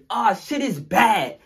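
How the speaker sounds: slow, high, loud drawn call of alarm; corrected line. Retold or imitated. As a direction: drawl it out in alarm. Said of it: "No, not it's down".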